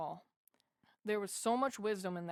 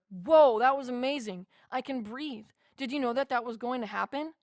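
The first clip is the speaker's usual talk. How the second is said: loud whoa, then higher, excited questions; amazed; 3 semitones higher, 6 dB louder than usual. Retold or imitated. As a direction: burst out in wonder. Amazed reaction after vision.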